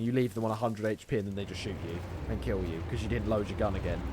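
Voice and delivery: normal talking voice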